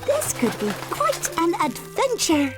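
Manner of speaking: high, gushing and thrilled; delighted girl. Acted. Golden Girl gushing to Annie Apple about the trip. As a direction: brim over with plans.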